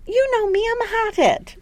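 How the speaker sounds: high-pitched acted protest; high mock voice. Recapper as would-be teacher explaining himself, high mock voice.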